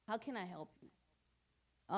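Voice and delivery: slow, soft and gentle, like a kind caseworker; soft caring offer. Shown as a demonstration. Caseworker offering help to a wary family, softly and slowly. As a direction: ease the question out tenderly. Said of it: "instead of coming at you like".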